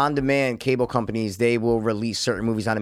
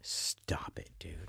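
whisper; 9 semitones lower, 19 dB quieter, much slower than usual. Real, not acted. Reacting to the ghosts remark.

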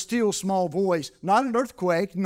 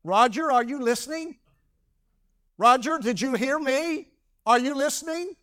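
higher, calling voice of a mother checking on her son; imitating mama; 7 semitones higher than usual. Preacher quoting his mama scolding young Roger, pitched higher. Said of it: "I heard my mama say that a lot".

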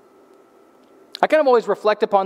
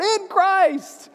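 much higher playful cry from the back seat; mock scream; 12 semitones higher than usual. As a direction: blurt it out to break the silence. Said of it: "will scream out".

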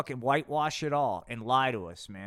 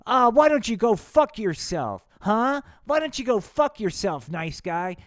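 tough guy; 7 semitones higher, 10 dB louder than usual. Acted. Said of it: "Kim Jong-un goes".

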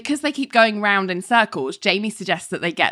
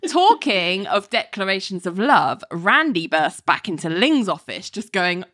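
usual speaking voice

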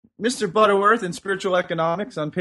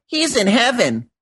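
lightly voiced eager pupil, higher pitch; pupil answering in class; 7 semitones higher than usual. Pupil answers the teacher's question, lightly acted higher voice.

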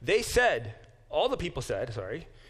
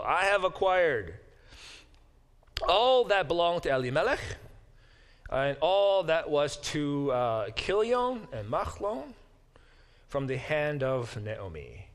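solemn proclamation; 2 semitones higher than usual. Acted. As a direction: declare it for all the witnesses. Boaz proclaims the purchase.

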